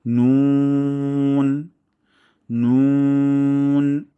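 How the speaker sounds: loud, very long নুন with rounded lips; thin, round-lipped nun. Shown as a demonstration. Teacher modelling for practice, long round-lipped নুন. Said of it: "ঠোঁট একদম গোল হয়ে যায়".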